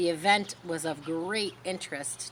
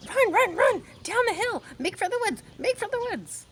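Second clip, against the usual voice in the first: much higher, loud goose cries; frantic goose hollering; 5 dB louder than usual. Acted. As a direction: urge the pig to flee. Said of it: "she started hollering".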